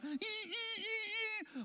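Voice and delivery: high squeaky ee with each pin jab; mock voodoo squeals. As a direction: squeal like stabbing a voodoo doll. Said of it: "you're going".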